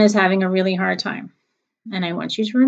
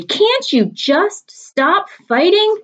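slow, much higher exasperated snap; snapping; 11 semitones higher, 5 dB louder, much slower than usual. Acted. Mom snapping at the fighting kids. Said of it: "She snapped at Schubert and Sophie".

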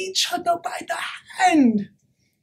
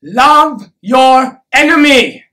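loud, slow, each word separate; slow, thundering proclamation; forceful command; 10 dB louder, slower than usual. Acted. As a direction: hammer out every word at full volume. Same command repeated far more forcefully.

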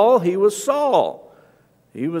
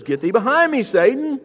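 raised, emphatic acted rebuke; stern correction; 6 semitones higher than usual. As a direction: correct him sternly, pitch lifted. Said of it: "does it rather sternly".

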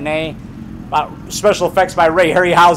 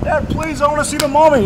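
put-on high, begging little boy's voice; whiny kid pleading with dad; pleading child; 8 semitones higher than usual. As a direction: beg like a five-year-old on his knees. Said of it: "I go".

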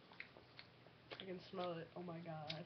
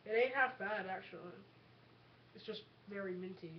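eating gross jelly beans; 5 dB quieter than usual. Real, not acted. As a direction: mouth full of toothpaste bean. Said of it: "chew it and swallow it".

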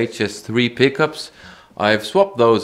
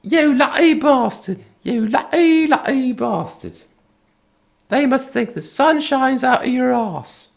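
much higher mock-envious squeal; envious friend. Repairman teasing the lucky buyer in a much higher mock-envious squeal. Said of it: "And he said".

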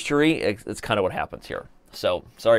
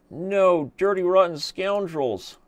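mock outrage; 8 semitones higher, slower than usual. Real, not acted. Just lost the game on time.

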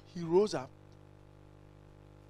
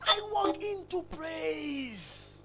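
slow; 7 semitones higher, slower than usual. Real, not acted. Preacher describing entering worship.

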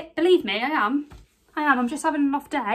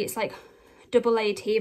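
ordinary talk, nothing marked

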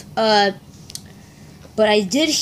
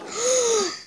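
sharp gasp; 13 semitones higher, 5 dB quieter than usual. Acted. Reacting to the confession.

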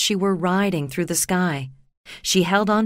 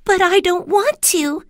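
high, louder protest; objecting; 12 semitones higher, 4 dB louder than usual. Acted. Dorothy refusing to live with the Munchkins, high protest. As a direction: refuse firmly. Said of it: "Dorothy objected".

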